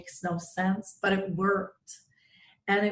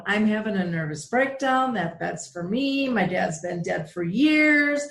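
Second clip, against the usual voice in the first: exasperated disbelief; 6 dB louder than usual. Retold or imitated. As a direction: protest loudly at an absurd suggestion. Retold inner outburst at dad's whisper.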